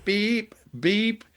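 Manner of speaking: high piping electronic beeps; two sharp high beeps, mocking the sound; imitated beeping. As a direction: mimic an annoying machine beep. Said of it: "there was a constant beep".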